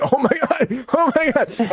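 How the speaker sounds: high, breathless oh-my-God through real laughter; laughing disbelief. Real, not acted. Host cracking up at Nikki Glazer's Ben Affleck joke, laughing.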